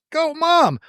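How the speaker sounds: high, bright little cheer for mom; mock cheer. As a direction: cheer her on like a kid.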